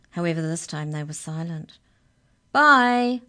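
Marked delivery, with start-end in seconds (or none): 2.5-3.2 high, loud farewell call. A narrator's reading. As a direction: call after someone walking away. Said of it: "called the mouse as they loped away".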